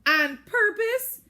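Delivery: very high, loud, slow shriek of disbelief; mocking shriek. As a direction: shriek it to humiliate her. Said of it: "Claire shrieks".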